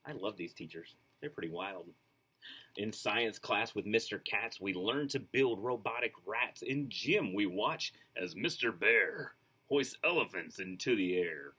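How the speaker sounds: put-on lively, sing-song reading to children; storybook read-aloud. A narrator's reading. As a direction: read it like a bedtime picture book. Reads picture book aloud.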